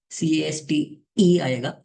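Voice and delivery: सीएएसटीई spelt letter by letter, slowly; spelling it out. Shown as a demonstration. Teacher correcting a typo to students, spelling slowly. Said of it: "sorry, लिखने में mistake हुई है".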